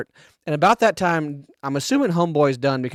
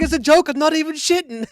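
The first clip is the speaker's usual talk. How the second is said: prankster; 13 semitones higher than usual. Retold or imitated. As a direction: pipe up high, pleading it's just a joke. Prankster voiced in retold video.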